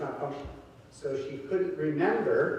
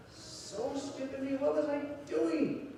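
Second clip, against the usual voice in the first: high, slow, soft lament; hushed, high-pitched mock remorse; regretful self-reproach; 10 semitones higher, 4 dB quieter, slower than usual. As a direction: imitate a man kicking himself, drawn out and high. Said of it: "he does something that he regrets".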